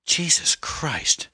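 high, long-drawn gasped-out oath; horrified exclamation. A narrator's reading. Anne realises who painted the three dead women, horrified.

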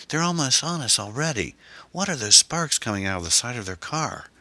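high, urgent alarm. A narrator's reading. Passenger watching pursuers close in, alarmed.

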